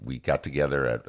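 ordinary talk

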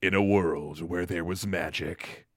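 deep, slow trailer announcer; low and slow like a movie trailer. Shown as a demonstration. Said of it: "say it in your trailer voice".

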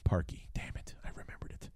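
very soft, near-whispered grumble; muttering to self. Real, not acted. Fan recalling a kicker he wanted forgotten, muttered under breath. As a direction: curse under your breath, dropping away to a whisper. Grudgingly recalls a name to forget.

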